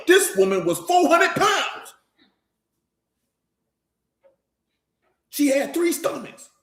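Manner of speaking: high, slow shouted mock of a smooth-talking man; mock flatterer. Preacher mocking men who flatter any woman, high slow hammed-up shout. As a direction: ham up the lying sweet-talker. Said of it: "I've seen men tell a beluga whale".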